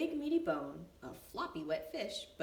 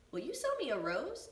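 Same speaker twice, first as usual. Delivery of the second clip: put-on young Benny; 7 semitones higher, 5 dB louder than usual. A narrator's reading. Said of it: "asked Benny".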